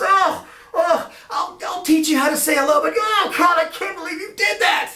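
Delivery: very high, loud wailing despair; exasperated boss. Retold or imitated. Boss promising to teach Lance hello, high loud exasperation. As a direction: groan and wail in high exasperation. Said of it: "And the guy goes".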